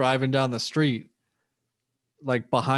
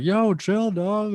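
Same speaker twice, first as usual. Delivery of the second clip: imitating himself, pitched way up; a hammed-up high 'yo, chill'; high-pitched chill out; 8 semitones higher, much slower than usual. Telling his friend to relax, high mock voice. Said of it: "I'm like".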